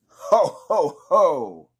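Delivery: high, booming mock Santa ho ho ho; Santa laugh. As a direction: greet like a jolly villain Santa. Santa appears.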